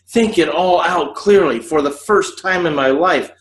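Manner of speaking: a man brooding before pulling the trigger; Paul's inner monologue. Acted. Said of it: "I'm going to do my best to act".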